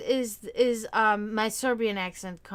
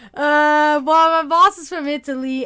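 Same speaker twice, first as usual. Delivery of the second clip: laughing; 5 semitones higher, 12 dB louder than usual. Real, not acted. Still laughing after big laugh.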